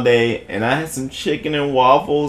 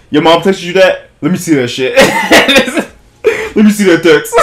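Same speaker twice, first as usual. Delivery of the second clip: laughing, louder mock demand to see the text; cracking up while demanding proof; 4 semitones higher, 6 dB louder than usual. Buddy grilling the girl, laughing through it.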